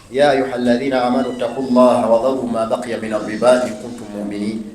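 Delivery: low, measured Arabic recitation; Qur'an recitation. Acted.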